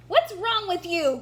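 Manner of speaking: loud, angry wolf snarl; growled. A narrator's reading. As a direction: snap it at them like a big bad wolf. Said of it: "growled the wolf".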